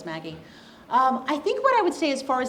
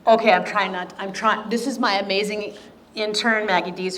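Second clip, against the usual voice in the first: fighting back tears; 7 dB louder than usual. Real, not acted. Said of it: "I'm trying not to cry".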